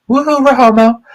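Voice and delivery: high cheering whoop; excited whoop. Acted. Cheering the beach town name.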